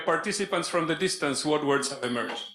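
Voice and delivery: ordinary delivery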